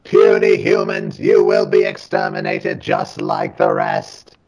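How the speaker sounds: high, slow robot voice; giant alien booming voice. Pretend. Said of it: "say some things that you'd want to say through a robot voice".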